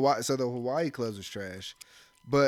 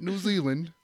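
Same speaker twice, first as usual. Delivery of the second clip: put-on higher-pitched go at a New Zealand accent; attempted New Zealand accent; 5 semitones higher than usual. Man retrying her home country's name, imitated New Zealand accent. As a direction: try once more at mimicking a New Zealand accent. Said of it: "I can't even do it".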